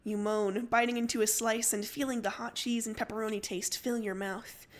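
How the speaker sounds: usual speaking voice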